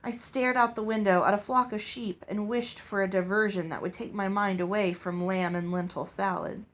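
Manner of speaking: ordinary delivery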